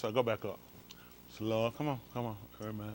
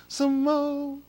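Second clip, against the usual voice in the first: higher, louder coaxing call; 9 dB louder than usual. Real, not acted. Urging the helper to scroll, higher and louder. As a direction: coax it up high and louder.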